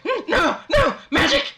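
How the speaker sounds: high squeaky panicked rat; panicked were rat. Acted. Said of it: "He's like".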